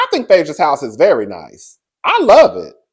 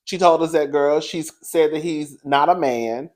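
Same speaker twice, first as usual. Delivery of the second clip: normal talking voice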